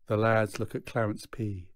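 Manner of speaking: plain reading voice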